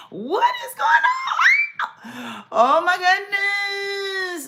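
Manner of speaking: very high, loud shocked cry; slow, wailing oh my goodness; shocked shout. Real, not acted. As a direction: cry out in disbelief. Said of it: "reacting to unrest news".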